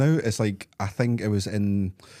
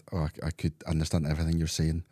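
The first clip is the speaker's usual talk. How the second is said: softer, lower, a touch of smugness; quiet smug reveal; 5 semitones lower, 6 dB quieter, faster than usual. Retold or imitated. To people gossiping about him, a quiet smug reveal. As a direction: let them know you understood, quietly. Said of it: "I said".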